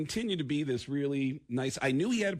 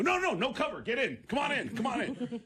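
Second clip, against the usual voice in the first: eager calling in; 7 semitones higher than usual. Retold or imitated. Eager doorman calling them in.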